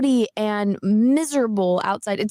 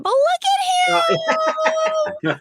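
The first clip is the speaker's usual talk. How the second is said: excited squeal; slower than usual. Real, not acted. Gushing over a cute puppy.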